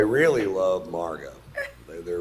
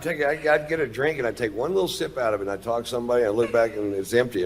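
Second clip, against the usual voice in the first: ordinary talk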